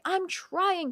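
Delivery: high-pitched imitated exasperation; mock frustration. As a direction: protest as if fed up, lightly acted. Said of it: "choose to be frustrated and say".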